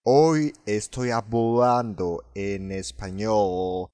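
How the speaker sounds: slow "hablando" and "español" with L blurred into an English O; English-O L sound. Shown as a demonstration. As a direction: mimic the faulty L in "español", turning it into a drawn-out O. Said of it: "の発音は英語のOじゃなくて、". (Lが英語のOになった「español」の真似)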